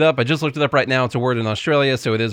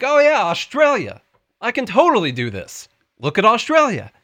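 mock manager; 9 semitones higher, 4 dB louder than usual. Co-host plays the manager faced with paying staff, mock confidence. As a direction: play up a manager's easy confidence, pitched up. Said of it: "he's gonna be like".

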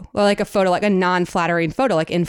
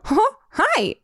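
high-pitched cartoon character greeting his sweetheart; Mickey Mouse. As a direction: squeak it out in short, excited cartoon bursts. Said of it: "I have a really good Mickey Mouse impression".

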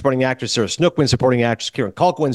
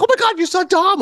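squealing imitation of shocked fans, much higher; mock shocked fan. Imitating fans shocked he met Tom, squealing high. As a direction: gush like a starstruck fan. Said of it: "people are shocked about is Tom".

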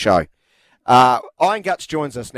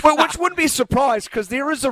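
excited outburst; 13 semitones higher than usual. Real, not acted. Host jumps in.

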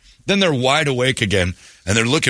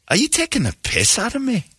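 high, mock-Scottish challenge; Scottish accent; 13 semitones higher than usual. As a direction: raise the pitch high and do a Glasgow accent, daring him. Retelling the angry Scotsman.

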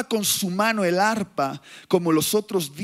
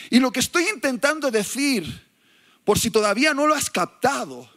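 ordinary talk

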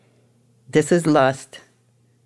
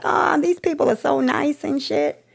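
naive younger self; 11 semitones higher, slower than usual. Retold or imitated.

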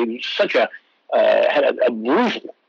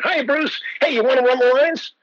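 put-on higher, bright greeting from an old star; Jack Lemmon, lightly voiced; 10 semitones higher than usual. Retelling how Jack Lemmon greeted him, in a light higher imitation. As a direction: mimic Jack Lemmon asking to run lines. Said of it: "I said".

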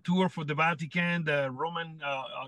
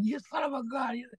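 retold curse; 7 semitones higher, 4 dB quieter than usual. Goulet chopping Owen over the money, mock curse. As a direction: imitate an angry outburst at high pitch.

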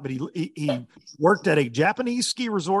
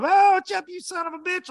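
very high, teasing buddy jab; joking insult; 3 dB louder than usual. Retold or imitated. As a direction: rib your buddy affectionately. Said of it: "like, joking".

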